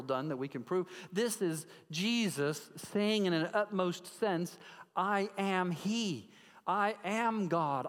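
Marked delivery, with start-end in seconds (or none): 5.0-7.9 slow proclamation. Acted. Jesus proclaiming, said slowly.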